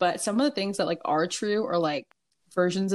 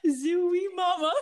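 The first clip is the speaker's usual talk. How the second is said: silly catchphrase; 8 semitones higher, much slower than usual. Pretend.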